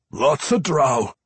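warning dwarf, high and loud; slow, loud dwarf warning; Pwent. A narrator's reading. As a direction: warn them as a dwarf vampire. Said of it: "the dwarf vampire warned".

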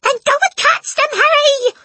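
very high, loud, shrieky order; shrill at him to act; urgent panicked hiss. A narrator's reading. Mother orders husband after burglars, shrill and frantic. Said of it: "hissed the mother".

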